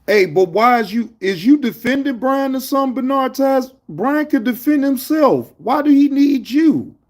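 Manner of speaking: high-pitched, indignant questioning; incredulous challenge. Real, not acted. Streamer calls out a chatter defending Brian, incredulous challenge. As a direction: pitch way up, demanding an answer.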